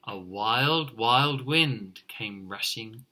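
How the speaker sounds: plain reading voice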